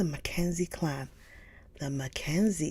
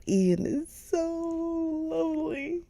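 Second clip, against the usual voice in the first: much higher, squealing Ian is so lovely; swooning; 13 semitones higher, 5 dB louder than usual. Real, not acted. Fan gushing to viewers about Ian, squealing. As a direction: gush like a fan. Swooning over book hero.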